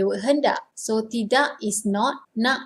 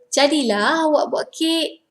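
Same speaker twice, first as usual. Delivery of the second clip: Jadilah awak buat kek, raised and impressed; correct intonation; 4 semitones higher than usual. Shown as a demonstration. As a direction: praise the cake. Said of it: "the correct intonation".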